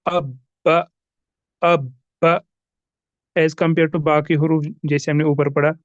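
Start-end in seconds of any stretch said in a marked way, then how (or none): 0.0-2.0 अब with the ब pressed hard and bounced; emphasis on a doubled qalqala letter; emphasized qalqala. Shown as a demonstration. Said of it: "थोड़ा सा stress डालेंगे बा पर".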